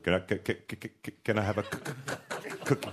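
quiet imitated stutter on the c. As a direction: mimic a stuck stutter. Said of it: "Instead of".

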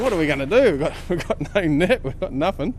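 high, flustered reenactment; mock panic. Angler realising there is no net, high mock panic. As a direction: relive the fluster, half laughing.

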